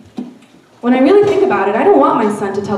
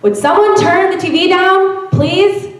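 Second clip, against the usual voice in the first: higher, slightly louder repeated request; insistent, higher-pitched plea to the family; a little louder; 8 semitones higher than usual. Acted. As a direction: raise the pitch and push a bit to be heard. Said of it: "a little louder".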